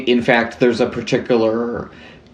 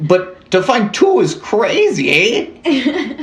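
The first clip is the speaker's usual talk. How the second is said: high, drawn-out cartoon Canadian accent; bad Canadian accent; 11 semitones higher, slower than usual. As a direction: ham up the Canadian, rising high on the eh. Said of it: "bad Canadian accent".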